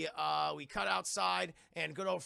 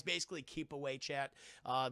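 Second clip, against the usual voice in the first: ordinary delivery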